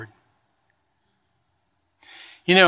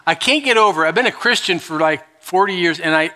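ordinary talk

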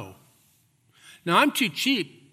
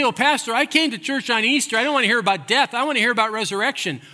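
higher, louder whiny objection; mock objecting churchgoer; 6 semitones higher, 4 dB louder, faster than usual. Pastor voicing a churchgoer's complaint, mock whiny higher voice. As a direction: play the listener pushing back on the pastor. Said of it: "You say".

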